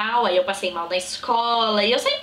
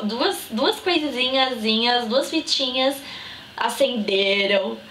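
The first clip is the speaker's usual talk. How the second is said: normal talking voice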